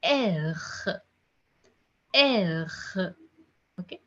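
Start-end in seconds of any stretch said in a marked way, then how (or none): 0.0-2.7 "R" as "air" with a gargled ending; gargled French R. Shown as a demonstration. Teacher drills the French letter R, gargled. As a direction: bring the throat sound out on "R". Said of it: "Try to take out the sound".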